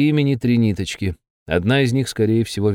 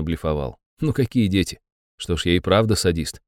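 ordinary delivery

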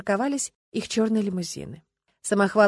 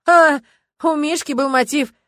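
outraged disbelief; 9 semitones higher, 12 dB louder, slower than usual. Acted. Witness outraged at accusation.

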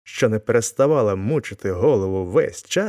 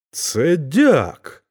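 higher, amazed exclamation; astonished; 6 semitones higher, 4 dB louder than usual. A narrator's reading. Chub recognizes the deacon from the sack, astonished. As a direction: blurt it out in amazement. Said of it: "промовив здивований більше всіх Чуб".

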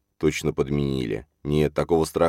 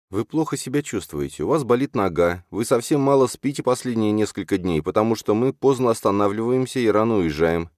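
higher-pitched, insistent heroine; concerned young woman; 6 semitones higher than usual. A narrator's reading. Alzhana fretting over Lali's health, concerned. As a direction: list the worries earnestly, pitched up.

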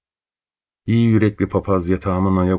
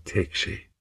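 whisper; 8 dB quieter than usual. Acted. Confession ending in breath.

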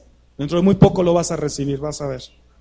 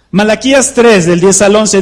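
much louder, booming the reference; shouting; 8 dB louder than usual. Shown as a demonstration. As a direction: call it out to the back of the church. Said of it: "¿O será que no estoy gritando?".

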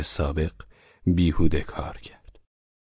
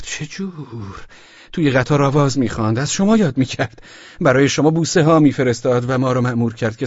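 put-on tipsy young man; 6 semitones higher than usual. Drunk young man answering Velchaninov, bewildered and high. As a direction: toss back the question, baffled.

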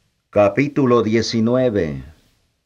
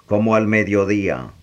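ordinary delivery, nothing marked

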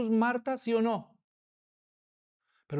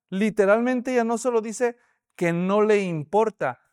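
ordinary talk, nothing marked

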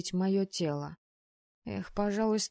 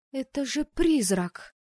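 higher, louder, slow alarmed cry; frightened cry; 6 semitones higher, 5 dB louder, slower than usual. Acted. Anna cries out, frightened, on seeing a ghost. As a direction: let the fright grow as it sinks in. Woman realises it's a ghost.

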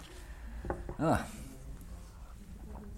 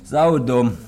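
disgusted outburst; 5 semitones higher, 10 dB louder than usual. Acted. Drunk outburst at the absurdity.

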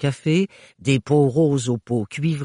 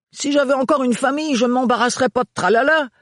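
mock shout over the train; 10 semitones higher than usual. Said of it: "s'égosilla Renard".

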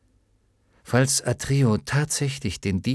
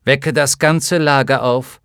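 louder, commanding order; 4 semitones higher, 7 dB louder than usual. Acted. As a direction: rouse the whole camp. Commanding the whole camp.

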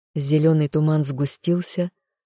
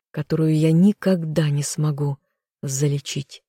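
ordinary delivery, nothing marked

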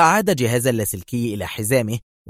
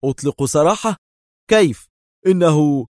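high, loud disbelief; outraged astonishment; indignant surprise; 5 semitones higher, 6 dB louder than usual. A narrator's reading. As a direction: protest in shock. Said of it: "في دهشة وهتف مستنكرا".

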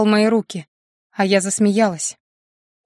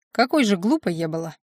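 ordinary delivery, nothing marked

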